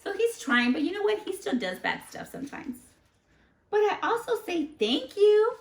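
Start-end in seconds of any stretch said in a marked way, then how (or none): 3.7-5.5 high and louder, pleased with himself; perky high boast; proud character voice. Acted. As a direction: show off his good manners. Character brags about manners.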